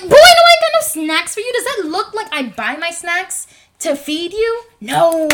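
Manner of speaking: loud, high-pitched outburst at a brother; exasperated scolding. Retold or imitated. Sister refuses her brother snacks, loud exasperated scolding. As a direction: tell him off at full volume.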